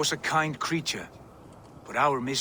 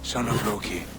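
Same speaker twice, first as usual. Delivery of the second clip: gentle plea; 7 semitones lower than usual. Acted. The visitor gently begs the wolf to trust him, lower tender voice. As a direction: soothe the wolf like an old friend.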